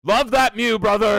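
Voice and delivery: loud, high battle-cry to a brother; hammed-up rallying shout; Warhammer space marine. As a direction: bellow it like a zealous warrior. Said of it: "sounded like it was from Warhammer".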